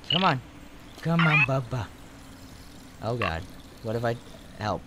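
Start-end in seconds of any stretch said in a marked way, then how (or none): none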